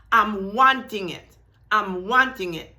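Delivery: wanting leaned on hard; stress on want. Shown as a demonstration. Teacher showing students the stress on wanting. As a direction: press down on "wanting" each time. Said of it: "Did you notice the emphasis I'm making".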